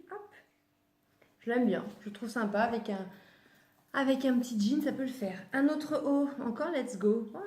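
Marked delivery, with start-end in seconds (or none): none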